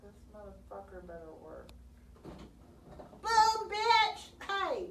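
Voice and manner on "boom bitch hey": triumphant shout. Real, not acted. Printer finally works, celebrating.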